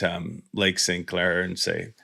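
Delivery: ordinary talk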